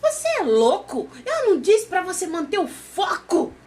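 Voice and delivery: high, loud, incredulous scolding; shocked outrage. Retold or imitated. Friend woken at night scolding him, shocked and high. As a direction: jump out of bed in disbelief and scold him.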